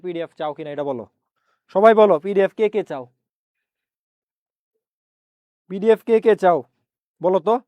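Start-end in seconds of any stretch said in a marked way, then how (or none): 1.8-7.6 calling out. Real, not acted. Calling on online students.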